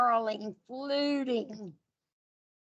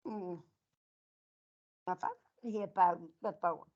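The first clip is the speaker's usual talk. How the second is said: ordinary delivery, nothing marked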